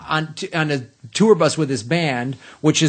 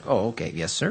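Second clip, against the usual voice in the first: lower, softer obliging assistant; respectful; 7 semitones lower, 7 dB quieter than usual. Retold or imitated. Secretary assistant humouring the caller, respectful. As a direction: imitate a meek, deferential secretary. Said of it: "so he's so respectful".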